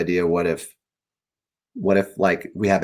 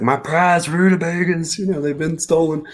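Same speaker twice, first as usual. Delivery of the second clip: skunk ape voice; 8 semitones higher than usual. Retold or imitated. Author voicing the skunk ape's complaint about stolen rutabagas, high and put-on. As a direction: whine it like a gardener robbed of his prize. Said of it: "and he's like".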